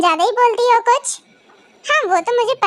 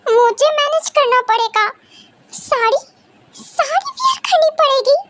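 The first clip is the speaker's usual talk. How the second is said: higher-pitched, taken aback; pitched-up, incredulous echo; dismayed disbelief; 6 semitones higher than usual. Acted. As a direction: balk at the task, voice rising. Dismayed at having to manage.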